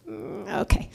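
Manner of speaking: low, soft, grudging okay; reluctant agreement. Acted. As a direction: give in with a doubtful mumble. Reluctantly agrees to therapist's advice.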